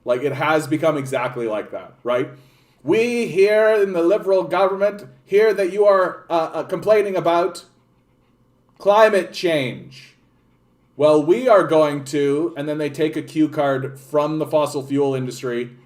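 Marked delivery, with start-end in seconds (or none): 2.8-12.5 pompous politician. Retold or imitated. Streamer mocks the Liberal government addressing voters, pompous announcement. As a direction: announce it like a stiff official.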